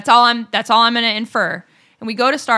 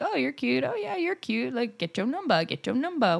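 flirty; 3 semitones higher, 9 dB quieter than usual. Retold or imitated.

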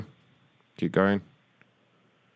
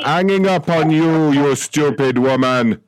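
high, loud outrage in a put-on accent; hammed-up furious hang-up; outraged; 5 semitones higher, 3 dB louder than usual. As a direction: shout the caller down in an odd accent. Accented clerk hangs up in outrage.

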